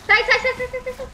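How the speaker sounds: high, rapid shouts of alarm; frantic yelling. Real, not acted. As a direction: yell to get them off. Yelling at zombies.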